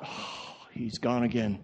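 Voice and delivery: low, hushed sigh of defeat; mock resignation. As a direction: sigh it softly, giving up. Resigned after Gabriel runs off.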